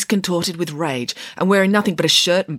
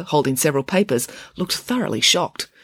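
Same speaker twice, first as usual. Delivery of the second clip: ordinary delivery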